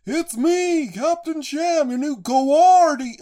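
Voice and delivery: high, loud hammed-up sailor; imitating a loud, high sailor voice. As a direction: belt it out like a cartoon sailor, drawing out the last word. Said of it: "like, the sailor voice was like".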